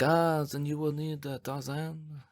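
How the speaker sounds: soft mock French accent; hushed French dude, letting the name drop away. French guy advising visitors bound for the volcano, soft French accent. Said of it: "some French dude who is like".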